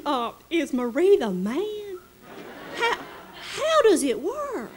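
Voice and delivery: high-pitched delighted Delta lady; Southern lady voice. Retold or imitated. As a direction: play the gushing Mississippi hostess. Charlotte sympathises, then asks nosily.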